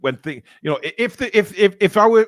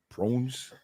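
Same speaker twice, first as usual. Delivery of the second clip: South African accent attempt; 2 semitones lower, 8 dB quieter than usual. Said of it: "say prawns in a South African accent".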